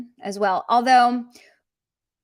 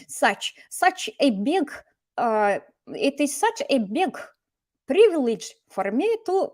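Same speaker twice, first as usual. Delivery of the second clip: put-on Russian accent; 3 semitones higher than usual. Teacher imitating how Russians say such, in a Russian accent. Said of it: "именно с таким акцентом, вот мягкий ч".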